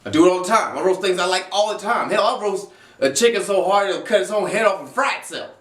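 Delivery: ordinary talk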